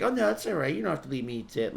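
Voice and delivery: high sing-song parody of a friend; mocking friend as cashier. Mocking his friend who sided with the cashier, high and sing-song. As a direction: chirp it back like a fake-sweet cashier. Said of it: "like the fucking cashier".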